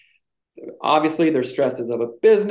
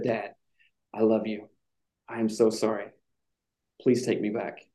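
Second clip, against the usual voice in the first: softer, lower pleading son; imagined son begging his dad to take him back, soft and lower; remorseful son; 5 semitones lower, 6 dB quieter than usual. Pretend. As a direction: plead softly as a sorry son. Son's imagined apology, voiced.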